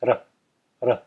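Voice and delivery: ra with the tongue lightly flicked against the roof of the mouth; tapped ra. Shown as a demonstration. Teacher drilling the Sanskrit ra for students. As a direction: loose jaw, a light single tap for each ra. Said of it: "Hopefully you can see my tongue there".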